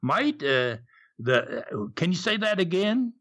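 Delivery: halting imitation of an Aussie driver; hesitant Australian cabbie, jumping high on the question; Aussie cabbie accent. Cabbie asking for the hotel again, mock Aussie accent. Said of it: "that Aussie accent".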